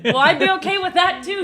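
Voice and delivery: high, loud, laughing retort; laughing protest. Real, not acted. Answering the co-host's bad girl joke, laughing protest. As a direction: protest loudly through real laughter.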